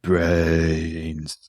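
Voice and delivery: slow drawn-out zombie moan. Acted. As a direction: groan the word like the undead. Zombie repeats at dinner.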